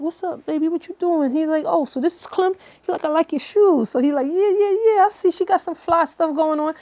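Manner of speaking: high, excited impression of D; imitating D. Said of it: "Why you sound like him".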